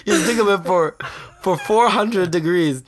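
Zach. Retold or imitated. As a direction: mimic a cooking host, settling as it goes. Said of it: "He's like".

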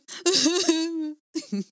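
high, loud burst of fake sobbing; exaggerated wail, played for laughs; comic over-the-top blubbering; mock crying. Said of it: "I just hear the word mom and I'm like".

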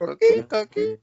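high squeaky coquí chirp; coqui frog call. Retold or imitated. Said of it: "it's like".

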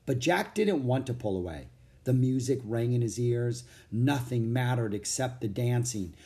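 ordinary delivery

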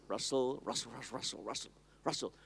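rustle, rustle, fading to a whisper; soft, creeping rustling sound; hushed rustle. Retold or imitated. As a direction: let the sound die away into breath. Imitating leaves rustling softly.

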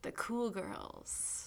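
slow, soft breathy whisper; whispered cool girl. Pretend. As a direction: breathe it out slowly, aloof. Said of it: "changing our voices to sound cool".

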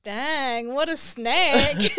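slow, loud, stretched-out tease; mock accusing drawl; teasing drawl. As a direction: draw it out playfully. Quoting a friend's message.

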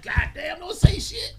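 loud, high, laughing outburst; alarmed protest. Retold or imitated. Bird begging screamers to hush, loud and high. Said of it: "I'm like".

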